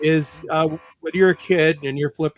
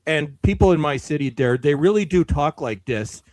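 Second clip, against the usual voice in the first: thick local Canadian accent, opening loud; 6 dB louder than usual. Shown as a demonstration. Canadian telling friends how people in their city talk, Canadian accent. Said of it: "sound sort of like this there".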